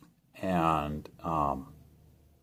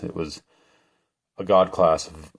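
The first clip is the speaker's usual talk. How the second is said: ordinary talk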